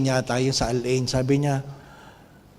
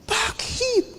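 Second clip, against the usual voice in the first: high rhetorical why; 6 dB quieter than usual. Acted. Preacher asks a rhetorical question.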